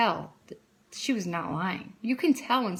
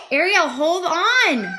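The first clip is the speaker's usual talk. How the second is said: calling out; 9 semitones higher, 7 dB louder, slower than usual. Real, not acted. Mother calls to interrupting Ariel, loud and stretched out. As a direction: slowly call out to make them wait.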